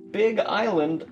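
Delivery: normal talking voice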